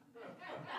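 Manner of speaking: breathy chuckle; short laugh. Real, not acted. Poet laughing mid punchline, quick chuckle. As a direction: laugh out at own joke.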